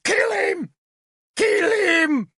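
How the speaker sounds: shrieked high, a crying scream. A narrator's reading. Druid shrieking at the recognized spy, screaming. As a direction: scream for his death. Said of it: "recognized me and screamed".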